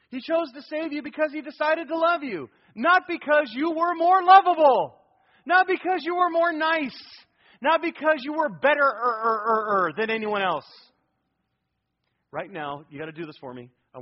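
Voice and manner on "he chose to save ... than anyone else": higher and louder, building to a near shout; loud preacher's peak, pleading against self-righteousness; preaching climax. Real, not acted. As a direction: drive it home from the pulpit.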